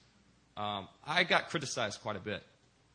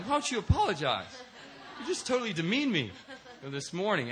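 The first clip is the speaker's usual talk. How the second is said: much higher, laughing mock protest; laughing indignation; 8 semitones higher, 3 dB louder than usual. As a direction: complain with a laugh, half outraged. Retold inner complaint with laughter.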